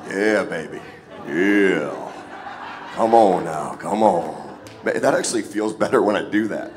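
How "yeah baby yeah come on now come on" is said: lower, louder, smooth crooning bass with a laugh. Pretend. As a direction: croon it like a soul singer romancing the room.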